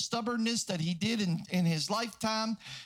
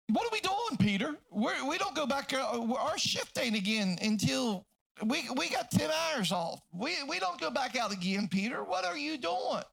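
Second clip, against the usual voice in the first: incredulous protest; 3 semitones higher than usual. Acted. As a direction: play a fisherman balking at his crewmate. Acts out protesting fellow fisherman.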